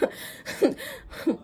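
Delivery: high airy laugh; breathy nervous laugh. Real, not acted. Remembering being called out to the ex, breathy laugh.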